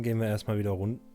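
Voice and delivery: ordinary talk, nothing marked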